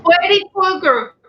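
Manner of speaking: put-on high, mildly scared little girl voice; a hint of a scared three-year-old; child's scared quote. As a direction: lightly voice a frightened small child. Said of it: "And she said".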